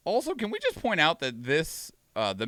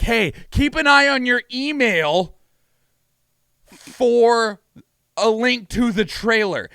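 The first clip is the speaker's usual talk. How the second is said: mock developer voice; 7 semitones higher, 6 dB louder, slower than usual. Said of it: "and they're like".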